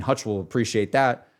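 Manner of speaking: normal talking voice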